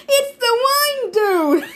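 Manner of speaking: very high, drawn-out shout; yell. A narrator's reading. Blackjack sees who has trapped them, yelling in alarm. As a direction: wail it out loud and high. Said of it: "Blackjack yelled".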